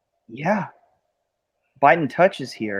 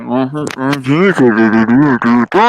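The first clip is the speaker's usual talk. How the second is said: muffled mm-hmm; 2 semitones lower, 8 dB louder than usual. Retold or imitated. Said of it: "you sounded like".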